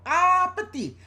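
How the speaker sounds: very high, loud toddler imitation; mimicked little girl proudly calling out; imitating toddler speech. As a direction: squeal a long "Ah" into a garbled "petit". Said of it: "Every time she would go".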